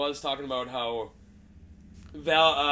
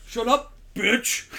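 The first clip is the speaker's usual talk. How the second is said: mock insult; 8 semitones higher, 6 dB louder, much slower than usual.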